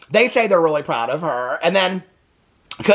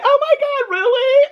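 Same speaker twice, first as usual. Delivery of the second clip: very high, squeaky fake-crying voice; mock tearful; 3 dB louder than usual. Brescia reacting to her parents' approval, mock tearful squeal. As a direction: imitate an overcome daughter, pitched way up. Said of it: "Brescia's crying and she's like".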